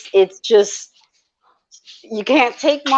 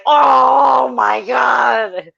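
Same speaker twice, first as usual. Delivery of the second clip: slow, loud, drawn-out wail of dismay; mock anguish; 4 semitones higher, 4 dB louder, much slower than usual. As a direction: overplay the heartbreak at the horse. Reacting to injured horse in movie.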